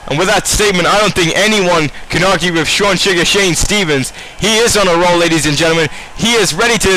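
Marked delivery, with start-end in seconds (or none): none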